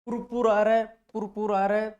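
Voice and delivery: loud, high, punching रहा; emphatic teacher call. Shown as a demonstration. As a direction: drill it out loud for the class. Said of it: "Kapoor चंद पाटनी". (ज़ोर से दोहराते हुए, रहा पर ज़ोर)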